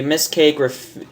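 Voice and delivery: ordinary delivery, nothing marked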